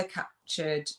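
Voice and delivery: usual speaking voice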